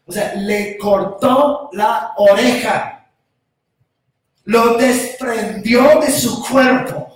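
slow, loud, weighted words; emphatic preaching. Acted. Preacher driving home the severed ear, slow and loud. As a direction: lean hard on every word.

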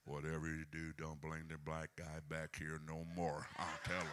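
low, quiet put-on voice of a fretting member; deep muttered church-member voice; mock church member. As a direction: grumble it low like a nervous congregant. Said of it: "Goes like".

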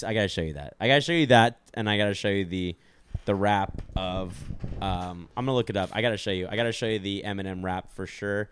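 normal talking voice

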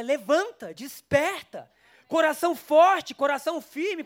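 rally them up, voice swelling; rising preacher's call. Acted. Preacher rousing the congregation, swelling to a shout. Preacher rallies the church to rise.

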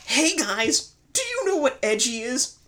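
high, bouncy presenter voice; hyped review host. Acted. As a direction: sell the rant like a show host.

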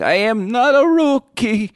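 slow, very high, loud mock wail on every word; cabbie breaking down. Cab driver insisting on his experience, escalating mock breakdown. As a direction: hammer it out like a driver losing it. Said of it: "a guy on the verge of a breakdown".

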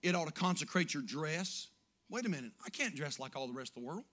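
ordinary delivery

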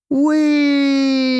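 very high, loud gleeful cry; Mickey sliding down the pipe, delighted; gleeful whee. A narrator's reading. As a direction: shout it sliding down a pipe for fun. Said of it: "who was enjoying himself".

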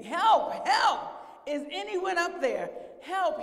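high, loud cry for rescue; yelling for help. Acted. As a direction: call up desperately to anyone who might hear. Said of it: "he yelled for hours".

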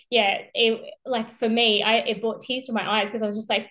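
usual speaking voice

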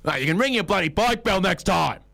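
lightly voiced annoyed middle-aged man; mock-grumble at a cyclist; grumpy cyclist-hater. Two middle-aged men scolding him, a hint of mock grumble. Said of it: "they said".

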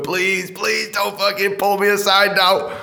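slow, drawn-out mock plea; hammed-up desperate pleading. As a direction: beg under pressure, stretching each please. Acted silent plea in the queue.

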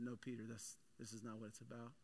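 soft, calm rebuke; Jesus softly correcting Peter after the sword strike; gentle correction. Retold or imitated. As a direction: quietly set him straight.

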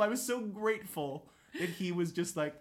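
normal talking voice

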